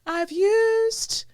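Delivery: high, slow squeaky joke voice; pretending to have blown the advance; mock confession. Teasing listeners with a high, slow fake confession.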